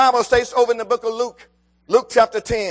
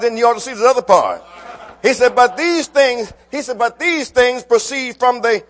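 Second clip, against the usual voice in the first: rising preaching; 4 dB louder than usual. Acted. Preaching builds again.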